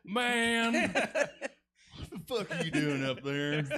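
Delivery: slow, laughing Southern imitation; imitated Southern husband. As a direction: play a baffled Southern husband. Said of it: "Somebody's southern husband is here".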